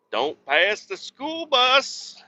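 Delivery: slow sing-song warning. Real, not acted. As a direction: warn yourself in a high, slow singsong. Reminding himself about school bus.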